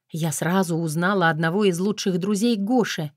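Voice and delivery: ordinary delivery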